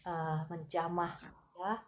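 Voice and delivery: fervent healing prayer. Acted. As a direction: pray with rising force, pressing in. Healing prayer over a viewer. (doa penyembuhan yang berapi-api)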